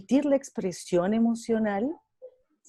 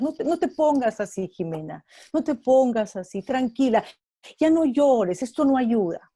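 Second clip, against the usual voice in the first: higher and louder, urgent stop-crying pleas; pleading comforter; 6 semitones higher, 5 dB louder than usual. Retold or imitated. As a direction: beg her to stop crying, building up. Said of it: "lo primero que usualmente hacemos es".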